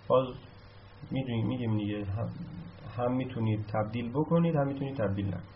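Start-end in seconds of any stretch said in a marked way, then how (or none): none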